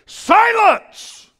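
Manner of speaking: Jesus shouting at the wind; commanding shout. Acted. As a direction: bellow it at the storm to make it stop. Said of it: "what he actually said to the wind was".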